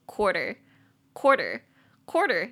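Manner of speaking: "quarter" said slowly, stress on first syllable; teacher pronouncing "quarter" slowly; slow clear pronunciation. Shown as a demonstration. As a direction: model "quarter" for learners. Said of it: "那个字就是quarter".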